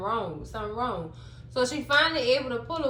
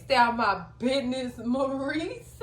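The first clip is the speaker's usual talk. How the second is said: ordinary talk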